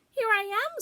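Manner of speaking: very high little squeak; squeaky Roo. A narrator's reading. Roo calls out to Eeyore, very high squeak. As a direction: pipe up like a tiny joey. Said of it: "squeaked Roo".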